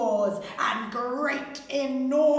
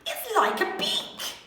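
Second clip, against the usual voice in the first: grumbling mum; 6 semitones higher, 3 dB louder than usual. A narrator's reading. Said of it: "She grumbled".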